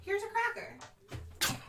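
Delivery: high, slow sing-song offer. Pretend. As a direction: talk high and slow like to a pet. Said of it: "get on your chipmunk voice".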